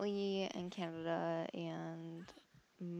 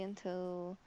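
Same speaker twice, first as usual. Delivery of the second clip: usual speaking voice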